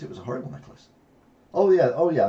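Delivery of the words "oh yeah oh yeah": realisation. Real, not acted. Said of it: "I see what you mean".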